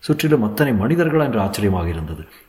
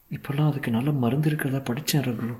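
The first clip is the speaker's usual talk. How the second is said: soft, higher woman's voice; tender encouragement; 5 semitones higher, 6 dB quieter than usual. A narrator's reading. Wife telling husband of new medicine, soft tender voice. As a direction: offer hope gently and softly. Wife offers hope softly.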